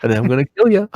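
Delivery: lightly voiced menacing film villain; a hint of a demon stalking his prey; Willem Dafoe. Demon coming down the rows after his victim, light Willem Dafoe voice. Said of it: "say it in that Willem Dafoe voice".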